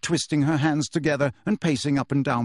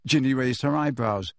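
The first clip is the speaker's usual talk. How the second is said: plain reading voice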